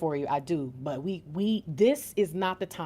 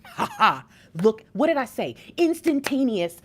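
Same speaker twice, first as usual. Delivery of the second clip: loud, triumphant, laughing crow; excited vindication; 5 semitones higher, 7 dB louder than usual. Real, not acted. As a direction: crow loudly that you called it. Crowing that her call was right.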